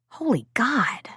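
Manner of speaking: breathless whispered holy God; whispered exclamation. A narrator's reading. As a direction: let it slip out in a whisper. Exasperated inner thought.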